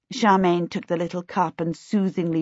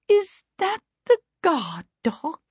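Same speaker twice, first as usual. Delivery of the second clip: high, timid quaver; 12 semitones higher than usual. A narrator's reading. Missus Baker seeing Waif trot in, quavering. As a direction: ask it nervously, high-pitched. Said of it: "Missus Baker quavered".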